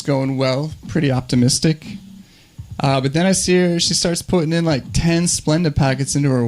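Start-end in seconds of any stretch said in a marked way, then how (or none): none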